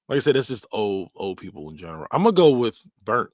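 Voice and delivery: ordinary talk, nothing marked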